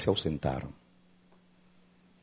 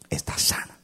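hushed declaration. Acted. Pastor declaring healing.

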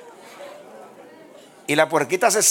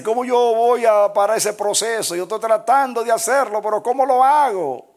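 higher, louder exasperated questioning; desperate plea; 8 semitones higher, 6 dB louder than usual. Retold or imitated. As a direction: throw up your hands in despair. Desperate believer asks how to stop.

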